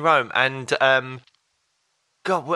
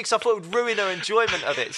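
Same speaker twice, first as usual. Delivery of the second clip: ordinary talk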